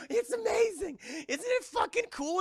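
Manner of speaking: very high thrilled voice; giddy excitement. Retold or imitated. Mother thrilled by the ghost, very high gushing. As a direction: gush like a delighted kid.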